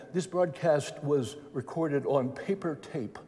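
put-on a light, slow English accent, said quietly; quiet hint of an English accent. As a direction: mimic the English announcer's accent, softly. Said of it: "as the guy said in his English accent".

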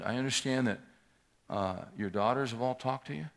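usual speaking voice

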